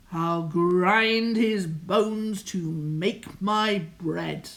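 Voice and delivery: rumbling giant bellow, loud; giant shouting. Acted. Giant bellowing from behind his frightened wife, loud roar. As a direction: roar like a giant sniffing out a thief. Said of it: "Her husband's shouts rumbled".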